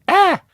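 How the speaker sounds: short, high, loud mock cry; imitated yelp. Mimicking the wrestler on the apron, short loud yelp. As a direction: let out a sudden silly yelp. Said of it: "She just got up a little bit and was like".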